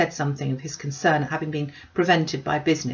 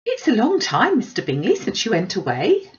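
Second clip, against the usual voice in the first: higher, louder gushing mother; a chattering, eager matron greeting her guest; fussy Mrs Bennet; 5 semitones higher, 5 dB louder than usual. Acted. Mrs Bennet voiced by narrator.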